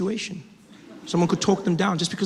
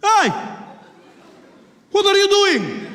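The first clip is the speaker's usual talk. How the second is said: indignant challenge; 7 dB louder, slower than usual. Acted. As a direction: yell across the cabin to challenge him. Passenger confronts the would-be bomber.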